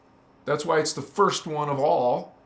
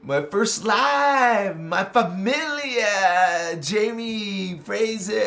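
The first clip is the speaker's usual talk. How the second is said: slow, stretched cheering shout-out; drawn-out calling out; 3 semitones higher, much slower than usual. Real, not acted. Hailing family on the first live, drawn-out cheer. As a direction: hail each one with long, held vowels.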